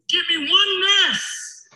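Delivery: slow, loud and high on one; loud proclamation. Acted. Quoting Elijah Muhammad to the crowd, booming. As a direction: call it out big. Preacher quoting, building crescendo.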